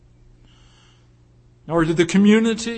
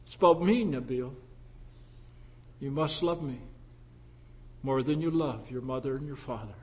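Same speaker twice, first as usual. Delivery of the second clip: soft, intimate voice of God; 7 semitones lower, 11 dB quieter, faster than usual. Retold or imitated. God speaks to Nabil.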